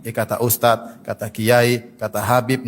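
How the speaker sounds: slow, low mock excuse-maker; imitating a careless follower citing clerics. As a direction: drawl it out like a lazy excuse. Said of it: "jangan dibilang lagi".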